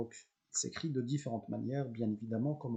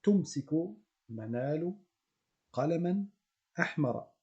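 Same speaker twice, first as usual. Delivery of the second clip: slow model reading; 2 semitones higher than usual. Shown as a demonstration. Teacher reads the example sentence to students, slow and clear. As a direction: read the Arabic sentence slowly and clearly. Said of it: "On commence par la phrase de départ".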